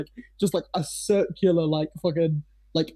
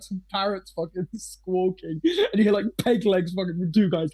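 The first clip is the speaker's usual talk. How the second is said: ordinary talk, nothing marked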